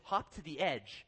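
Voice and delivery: unhurried, matter-of-fact instruction; retold guide's direction, drawn out; instructor's order. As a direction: give a slow, even command as the guide. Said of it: "And he says".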